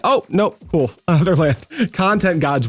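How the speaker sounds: ordinary talk, nothing marked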